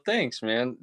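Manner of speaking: ordinary talk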